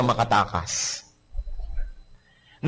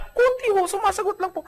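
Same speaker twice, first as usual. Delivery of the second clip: meek sheepish wife. Retold or imitated. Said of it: "Wala namang babae nagsabing".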